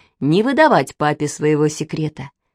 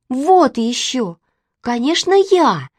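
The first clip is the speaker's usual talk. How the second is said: put-on high, indignant little-girl outburst; crying out; 6 semitones higher, slower than usual. A narrator's reading. Manechka claims she is the favourite, crying out. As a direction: pipe up indignantly, pitched high. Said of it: "закричала Манечка".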